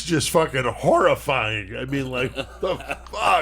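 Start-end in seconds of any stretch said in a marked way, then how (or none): 1.8-3.4 incredulous, laughing outburst; laughing disbelief. Real, not acted. Podcast host reacting to a horrifying story, laughing in disbelief. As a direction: break into a laugh of real disbelief.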